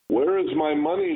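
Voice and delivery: loud, furious roar; roaring tyrant king. A narrator's reading. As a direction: bellow the demand at them. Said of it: "the king roared".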